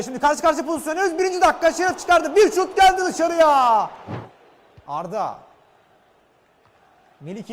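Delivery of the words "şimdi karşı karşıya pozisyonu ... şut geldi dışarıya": high, fast chance call building; excited football commentator. Real, not acted. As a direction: rise with the attack. Live chance on goal. (heyecanlı maç spikeri)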